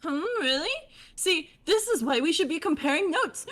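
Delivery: high bubbly chatty voice; high fish girl voice. Acted. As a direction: chirp it like a bubbly troll. Holds character voice.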